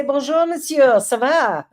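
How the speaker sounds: bright French bonjour said with a lilt; French greeting. Shown as a demonstration. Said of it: "go up and say".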